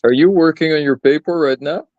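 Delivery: ordinary talk, nothing marked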